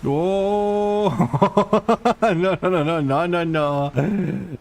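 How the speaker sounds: high, laughing no no no; laughing panicked protest; surprised panic. Real, not acted. Panicked near-death in game.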